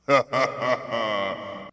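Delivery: slow, loud booming laugh; roaring giant laugh. Acted. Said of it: "the stone giant roared again".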